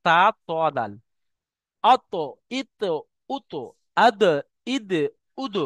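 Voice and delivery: Arabic articulation drill; drill syllables. Shown as a demonstration. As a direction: chant the vowel-plus-letter drill. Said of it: "এই তিনটে অক্ষর ওইরকম ভাবে উচ্চারণ হয়".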